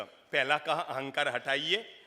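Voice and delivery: usual speaking voice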